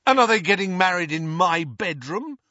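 loud, high sarcastic outburst; furious. Acted. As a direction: throw it back at her, furious. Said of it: "asked Ron furiously".

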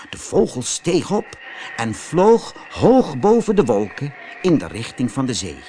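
plain reading voice

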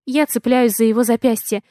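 ordinary delivery

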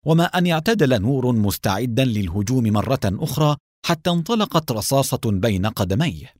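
ordinary delivery, nothing marked

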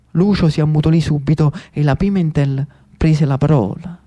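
plain reading voice

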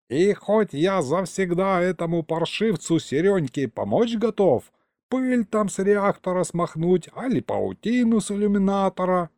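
put-on a rambling elderly grandfather, proud of himself; old grandpa storyteller. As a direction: tell it like a folksy old man, savouring every word. Grandpa continues tale.

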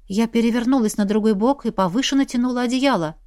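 usual speaking voice

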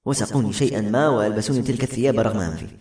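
ordinary delivery

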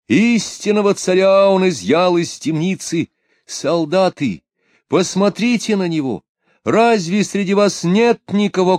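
high, loud priestly proclamation; fervent preaching. A narrator's reading. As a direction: announce a miracle to the crowd. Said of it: "он произнёс".

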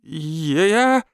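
nervous stutter on the first consonant; stammering. A narrator's reading. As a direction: stammer it out like a frightened nerd. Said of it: "заикаясь, спросил он". (испуганно заикаясь)

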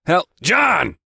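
loud, high bark. A narrator's reading. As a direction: bark the name at your employee. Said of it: "he barked".

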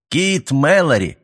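slow, grand exclamation; with pathos. A narrator's reading. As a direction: proclaim it like a hammy stage actor. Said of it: "с пафосом воскликнул Каперанг".

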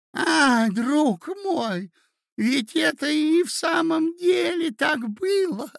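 high, loud ecstatic cry; delighted shout. A narrator's reading. Old prince shouting in delight to his nephew, high and loud. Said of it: "закричал князь в восторге".

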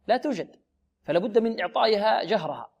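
ordinary talk

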